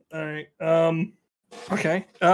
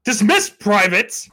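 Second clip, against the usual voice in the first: loud, drawn-out military order; officer's command; 2 semitones higher, 6 dB louder, much slower than usual. Acted. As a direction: call it out as a superior dismissing troops. Player plays officer dismissing the patrol.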